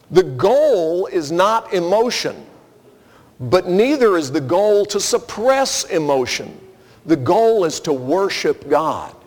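normal talking voice

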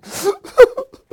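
a sudden high sob breaking through; the voice cracks into real crying. Real, not acted. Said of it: "I'll be okay in a minute".

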